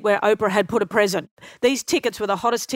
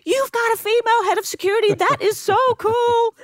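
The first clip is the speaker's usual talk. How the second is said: much higher, gushing star voice, lingering on "so cool"; Oprah impression, delighted; 13 semitones higher than usual. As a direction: rave about it like a thrilled celebrity. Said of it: "she goes".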